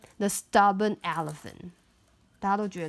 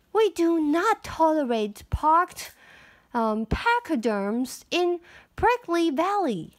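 proclaiming mayor; 6 semitones higher than usual. A narrator's reading. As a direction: announce it like a pompous mayor. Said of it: "she proclaimed".

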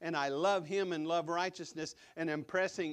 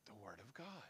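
whisper; 7 semitones lower, 16 dB quieter than usual. Real, not acted. Answering his own question intimately.

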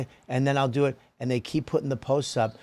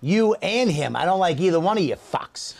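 ordinary delivery

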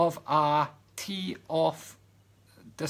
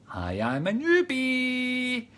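high, long drawn-out newbie greeting; high sing-song newcomer impression; mock newbie voice; 9 semitones higher, much slower than usual. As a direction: play an eager newcomer, stretching it out high. Voices newcomers' chat message.

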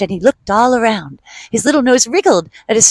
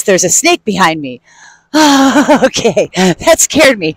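usual speaking voice

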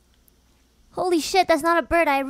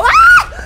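startled scream; 20 dB louder than usual. Real, not acted. Jump scare.

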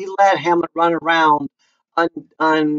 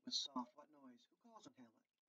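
hushed hiding Hamlet; 3 semitones lower, 34 dB quieter than usual. Acted.

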